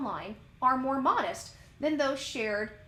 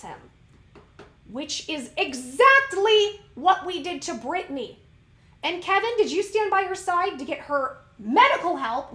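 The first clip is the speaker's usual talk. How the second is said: raised, emphatic indignation; ranty; 4 semitones higher, 4 dB louder than usual. Real, not acted. Host arguing for mothers' hormone care, ranty. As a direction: hammer each point home, truly worked up. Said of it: "I told you I'm gonna get ranty".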